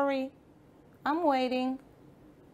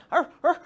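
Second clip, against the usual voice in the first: two short, higher mock barks; imitated dog bark; 4 semitones higher, 4 dB louder than usual. Dog answering the frog, playful imitated barking. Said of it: "said the dog".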